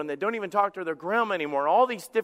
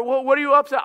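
raised, louder probing question; confronting question; 7 semitones higher, 7 dB louder, faster than usual. Acted. Spouse asking what you are upset about, confronting. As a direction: press them with a louder, higher question.

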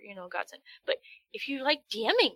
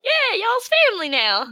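much higher, louder Southern farmer; 12 semitones higher, 11 dB louder than usual. Retold or imitated. Farmer welcoming players, loud high Southern accent. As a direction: welcome them in folksy Southern. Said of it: "And they're like".

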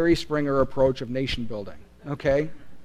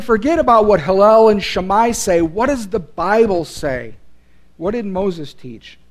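forceful challenge; 4 semitones higher, 8 dB louder than usual. Acted. Preacher voicing Jesus rebuking Pharisees.

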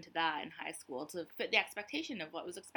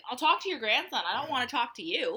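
louder, higher brush-off; mock dismissive; 6 semitones higher, 7 dB louder than usual. Brushing off old men who ask her origins, loud and cheeky. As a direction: play it as a cheeky refusal. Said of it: "And I'm like".

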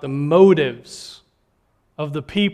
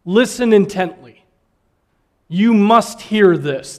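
slow, louder insistent appeal to listen; urgent solemn plea; 3 semitones higher, 6 dB louder, slower than usual. Pretend. Preacher voicing Jesus to the crowd, slow insistent urging. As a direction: press every word so they must listen. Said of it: "he uses these words to really say".

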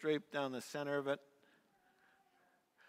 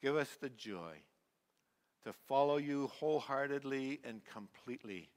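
prayer; 4 semitones lower than usual. Acted. Closing prayer.

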